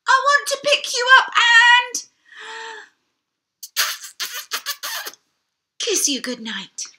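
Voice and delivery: high, loud, eager dragon voice; a big dragon pleading to give a goodnight kiss. Acted. Dragon begs to kiss them.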